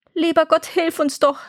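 high, plaintive little sister; wailing plea. A narrator's reading. Gretel crying to God for help, high lament. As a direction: beg heaven for help in a high voice. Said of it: "wie jammerte das arme Schwesterchen".

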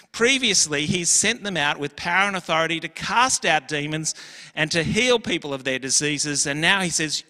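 ordinary talk, nothing marked

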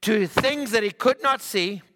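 high, loud, drawn-out exclamation; impassioned preaching. Acted. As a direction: preach it out in wonder, stretching the words. Preaches at full force.

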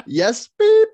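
high loud laughing beep; high bleep with a laugh; mock bleep. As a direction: bleep yourself out.

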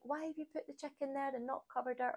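half-hearted mock telling-off; mild mock rant. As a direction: act out scolding a partner from another room. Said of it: "Rather than ranting and raving".

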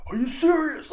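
high, strained mock yell; voice giving out. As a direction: imitate a wrestler losing his voice from yelling. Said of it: "Roman kinda loses his voice a little bit 'cause he's been yelling".

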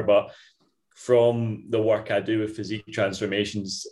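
normal talking voice

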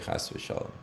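soft whispered heaven forbid; whispered aside. Real, not acted. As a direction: drop to a hushed whisper for the pious aside.

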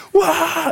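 put-on high-pitched whoop of teen joy. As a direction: yell it like kids out joyriding. Friends whooping in car.

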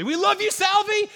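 high, full-volume crowd shout; yelling fan. Retold or imitated. Pastor imitating his own yell caught on video, loud cheering shout. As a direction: yell it like a fan screaming for a favorite player. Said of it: "me yelling essentially".

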